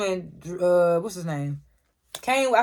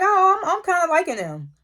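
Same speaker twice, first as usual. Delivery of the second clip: excited reaction; 10 semitones higher, 4 dB louder than usual. Retold or imitated. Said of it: "I was like".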